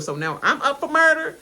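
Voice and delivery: ordinary talk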